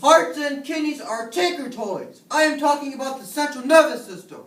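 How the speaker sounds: heated rebuttal. Acted. Professor rebuts a persistent student, loud and heated. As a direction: hammer the point home, exasperated.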